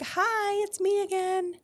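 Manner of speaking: very high sing-out hello; mock cheery greeting. Retells turning up yet another time.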